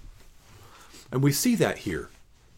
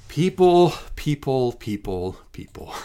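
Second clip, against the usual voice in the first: starts loud and high, dies to a whisper; exasperated, fading out; 3 semitones higher, 4 dB louder than usual. Acted. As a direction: call them out, then give up in despair. Exasperated sigh at the people.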